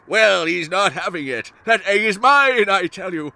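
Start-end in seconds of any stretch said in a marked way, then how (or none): none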